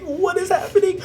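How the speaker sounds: high, laughing what; giggling bewildered squeak; laughing disbelief. Retold or imitated. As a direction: ask it through a laugh, stunned. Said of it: "I was like".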